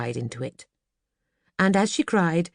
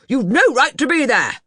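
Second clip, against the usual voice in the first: much higher and louder cross scolding; crossly; 9 semitones higher, 8 dB louder than usual. Acted. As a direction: snap at the ice cream man. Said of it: "said Mister Banks crossly".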